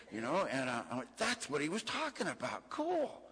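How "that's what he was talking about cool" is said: high-pitched retold revelation, climbing at the end; pleased realization. Seeing what his sponsor meant, high-pitched pleased realization. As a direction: act out a happy lightbulb moment, pitched up.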